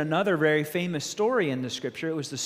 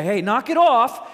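a loud, higher call to stop; prophet's rebuke; 7 semitones higher, 8 dB louder than usual. Acted. Prophet rebuking the people, loud scolding call.

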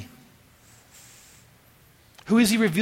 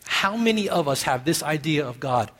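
ordinary talk

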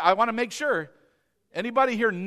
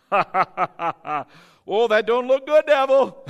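mocking laugh; 8 dB louder, much slower than usual.

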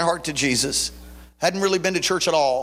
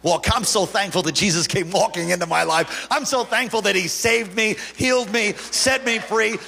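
preaching at full force; 10 semitones higher than usual. Acted. Preacher telling the congregation Jesus rescues them, full-force preaching. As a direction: lift the church with it.

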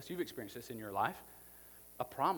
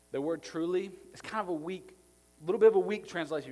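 preaching; 4 semitones higher, 9 dB louder than usual. Acted. Building into preaching.

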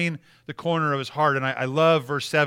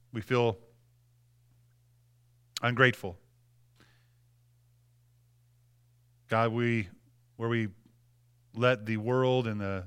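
quiet prayer; 6 semitones lower, 4 dB quieter than usual. Acted. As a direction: pray gently over the room, low and hushed. Leads the congregation in prayer.

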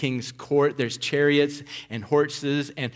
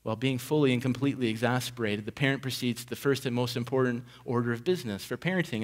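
ordinary talk

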